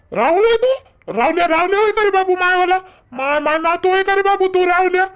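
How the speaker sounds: astonished recognition. Acted. Grandfather recognises his long-absent grandson, astonished. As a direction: pitch jumps up as recognition dawns.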